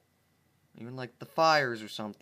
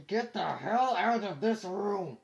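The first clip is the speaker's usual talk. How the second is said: slow, higher shout; angry yell; 8 semitones higher, slower than usual. Real, not acted. Gamer yelling at a sibling to leave the room. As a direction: order them out.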